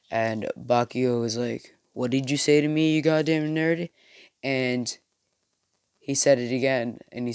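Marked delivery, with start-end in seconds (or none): 1.9-3.9 fast, loud, raised-pitch demand; angry retort. Acted. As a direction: fire it back like an offended bully. Bully retorts angrily in told story.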